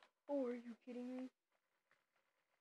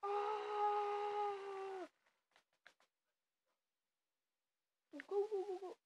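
panicking gamer; 8 semitones higher, much slower than usual. Real, not acted. Firefight panic.

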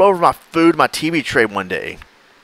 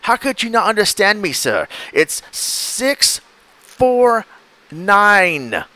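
much higher, spelling it out slowly in frustration; exasperated scammer; 9 semitones higher, much slower than usual. Retold or imitated. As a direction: drill the numbers into him. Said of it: "He goes".

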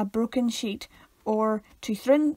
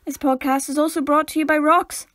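louder, playful announcer voice; mock sponsor read; 4 semitones higher, 5 dB louder than usual. Co-host naming rocks as a sponsor, mock ad read. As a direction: proudly announce a nonsense sponsor.

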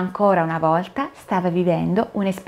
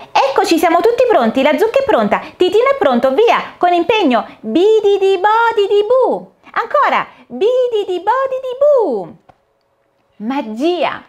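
fairy casting a spell; 12 semitones higher, 5 dB louder than usual. Acted. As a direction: chant the magic words with flourish. (fata che lancia l'incantesimo, forte e solenne)

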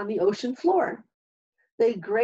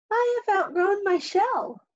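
much higher little crab voice; small crab voice; 10 semitones higher than usual. A narrator's reading. A smaller hermit crab asks Hermit Crab for a home, high and small. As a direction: speak up like a small young crab.

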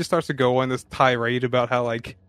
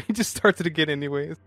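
ordinary talk

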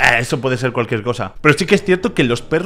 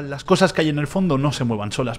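ordinary delivery

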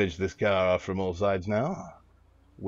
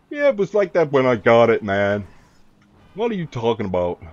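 loud, defensive Canadian-guy excuse; 3 semitones higher, 8 dB louder than usual. Acted. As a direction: brush off the dealer in a put-on Canadian voice. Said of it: "I'm suddenly a Canadian guy".

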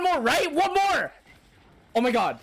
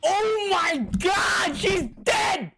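shocked shout; 7 semitones higher, much slower than usual. Real, not acted. Viewer reacting to a character's death, shocked shout. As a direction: yell it out in shock.

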